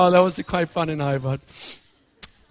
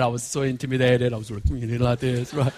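ordinary delivery

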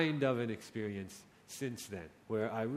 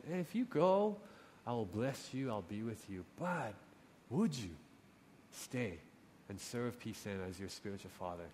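soft, much quieter, lower invitation; gentle God; 6 semitones lower, 9 dB quieter than usual. Retold or imitated. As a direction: coax him kindly, almost hushed. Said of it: "but he's so gentle with us".

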